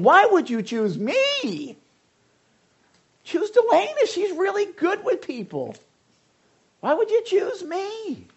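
high, slow whiny protest; mock self-doubt. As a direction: plead like an unworthy child. Pastor voicing his own doubt.

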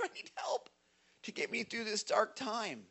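breathless whispered cry for help; whispered plea. Retold or imitated. David pleading with the Lord in a whisper. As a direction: drop to a whisper, begging. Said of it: "I'm crying out loud".

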